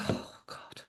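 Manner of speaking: breathy whispered oh God under her breath; hushed worried whisper; whispered dismay. Retold or imitated. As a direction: whisper it as a private groan of dismay. Whispered aside to herself.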